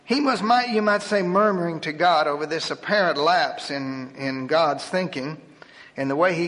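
usual speaking voice

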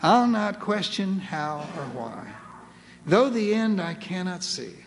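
solemn poem recitation. Acted. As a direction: let each line settle, easing down at the end. Poem closes in slow surrender.

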